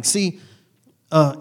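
ordinary talk

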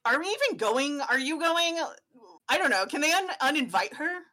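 high-pitched, gossipy outraged father; indignant dad. Acted. Dad ranting to his son about the barbecue invite, outraged. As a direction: huff about the neighbours' betrayal.

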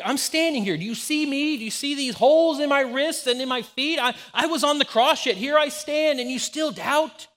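frustrated Jesus. Pretend. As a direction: demand to know why they doubt. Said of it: "How frustrating that would have been".

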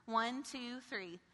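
ordinary talk

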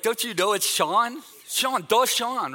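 hammed-up disbelief at not being recognized; mock indignant insistence. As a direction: act wounded that she doesn't know you. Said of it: "I'm like".

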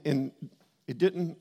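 normal talking voice

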